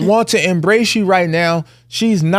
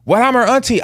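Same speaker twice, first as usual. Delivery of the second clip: higher mock protest; indignant aunt; 8 semitones higher than usual. Father mimicking his sister's protest, higher. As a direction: play the offended aunt. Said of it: "So I'm like".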